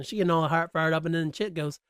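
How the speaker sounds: ordinary talk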